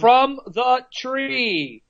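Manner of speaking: very slow, high, deliberate citation; emphatic slow quote. Acted. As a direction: draw out each word to prove the point. Quotes verse to prove his point.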